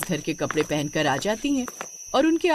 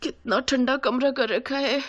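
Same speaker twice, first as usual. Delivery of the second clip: higher voice after shuddering exhales; acted shivery complaint; shivering with cold; 5 semitones higher than usual. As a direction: shudder it out, chilled through. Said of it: "कांपते हुए".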